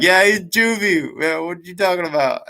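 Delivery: high, laughing imitation of mocking officers. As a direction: laugh through the jeering quote. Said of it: "the correctional officers started laughing".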